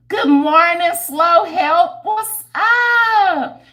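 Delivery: high, loud sung-out hello; loud greeting. Real, not acted. Greeting a viewer on the stream, loud and high. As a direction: call the greeting out brightly.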